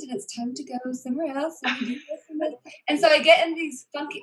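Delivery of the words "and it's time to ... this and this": hushed, playful British accent, much softer. Shown as a demonstration. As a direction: put on a very soft British accent. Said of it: "soft British accent".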